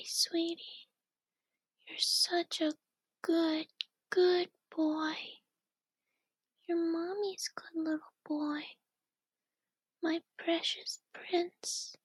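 ordinary talk, nothing marked

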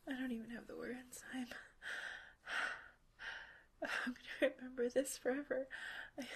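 hushed, close whisper held line after line; intimate whisper. Acted. Partner whispering to her lover during a roleplay, intimate whisper. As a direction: keep it all whispered and close to the mic.

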